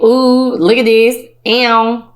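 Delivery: proud little victory squeal; sassy celebratory ow; showing off. Retold or imitated. Gloating over new job.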